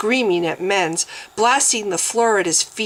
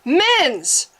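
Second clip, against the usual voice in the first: sharp high call; 9 semitones higher than usual. Acted. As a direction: call his name across the room. Calling out to Mens.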